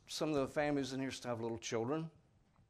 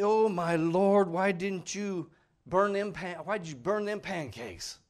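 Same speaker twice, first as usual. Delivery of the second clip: loud, drawn-out, higher-pitched complaint; mock exasperation; 5 semitones higher, 6 dB louder, slower than usual. Someone scolding over burnt pancakes, mock exasperated. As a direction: complain loudly, stretching the words in mock dismay.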